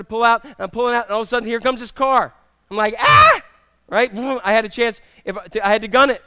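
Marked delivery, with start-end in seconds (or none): 3.0-3.4 high loud alarmed yelp; mock panicked yelp; startled scream. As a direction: yelp as the car appears. Car suddenly pulling out.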